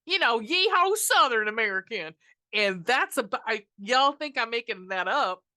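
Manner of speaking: yeehaw Southern twang, hammed up; mock a terrible Southern American accent; bad Southern American accent. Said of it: "as bad as the accents".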